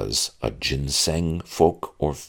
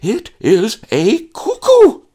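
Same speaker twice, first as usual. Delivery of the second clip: shrill tantrum; 12 dB louder, slower than usual. Acted. Maid's insistence escalates.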